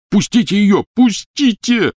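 high desperate yell. Acted. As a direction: scream at them to let go. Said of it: "орал Рон".

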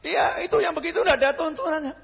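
a hammed-up preacher mocking an opponent; insistent critic.

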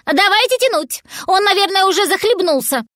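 high, agitated squirrel voice; worried. A narrator's reading. Squirrel fearing the cat has drowned, high agitation. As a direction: urge them anxiously, pitched well up. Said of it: "заволновалась белка".

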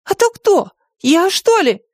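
very high, squeaky fury; fuming small creature; raging. A narrator's reading. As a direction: fire back indignantly in a high voice. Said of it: "продолжал бушевать Мартын".

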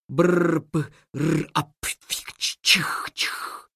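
slow breathy imitation of a sneezing motor; whispered engine sputter. The plane engine coughing before takeoff, sputtering.

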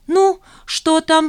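high, drawn-out greeting question; eager colleague. A narrator's reading. Karina greeting Zhanna for news, high and curious. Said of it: "встретила её Карина".

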